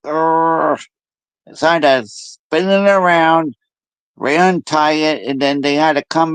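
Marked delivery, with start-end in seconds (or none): 0.1-0.8 long "urgh" of annoyance; groan-growl at the snag; mock frustrated growl. Tubing snagged on the machine, mock exasperated growl. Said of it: "I'm like".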